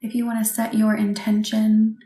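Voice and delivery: ordinary delivery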